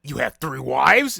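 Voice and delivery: loud, slowed disbelieving cry; can't believe what he just heard; shocked disbelief. Retold or imitated. Demon Gutaro stunned by Uzui's boast, loud disbelief.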